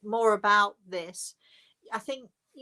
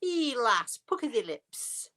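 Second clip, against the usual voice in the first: higher, playful Yorkshire call; bad mock-Yorkshire, drawn from a phrase list; terrible Yorkshire accent; 4 semitones higher, 3 dB louder than usual. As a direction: repeat it in hammed-up Yorkshire dialect. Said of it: "terrible Yorkshire accent".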